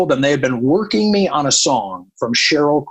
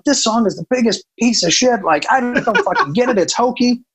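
retold rant; 9 semitones higher than usual. Radio programmer retelling his rant about a song, exasperated. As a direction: rant in a raised pitch, retelling your own tirade. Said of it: "as I'm ranting".

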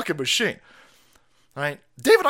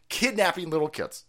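normal talking voice

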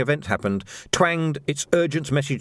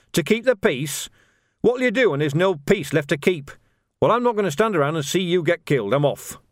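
higher, heated retort; scornful Ned Coates, fed up; 6 semitones higher than usual. A narrator's reading. Coates refusing to watch the lads get killed, scornful and fed up. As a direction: throw it back at them, pitched up.

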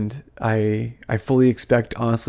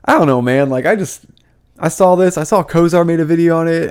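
loud, worked-up venting; heated and louder, pitch lifted; getting heated; 5 semitones higher, 7 dB louder than usual. Real, not acted. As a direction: rant with the volume pushed up. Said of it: "I'm getting heated".

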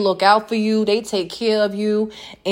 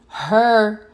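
ordinary delivery

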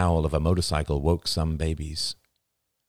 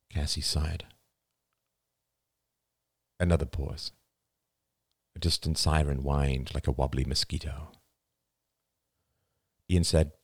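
usual speaking voice

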